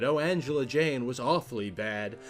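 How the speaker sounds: usual speaking voice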